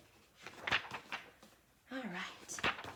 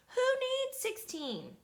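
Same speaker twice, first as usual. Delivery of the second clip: indignant; 13 semitones higher, slower than usual. Acted. Indignant child retort.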